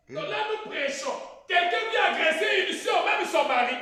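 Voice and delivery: loud preacher cry; fired-up preaching. Acted. Preacher telling the church about an attacker, loud preaching. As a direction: preach it out hard to the hall.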